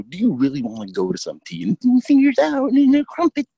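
high, loud mock-dainty jeer; mocking incredulity. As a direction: poke fun at the fussy tea party. Mocking a fancy retirement tea to friend.

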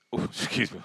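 normal talking voice

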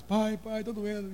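put-on high whimpering child's voice; crying little boy. Son on the hospital bed begging dad, high crying child voice. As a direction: plead like a hurt three-year-old. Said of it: "ele chorando de lágrimas".

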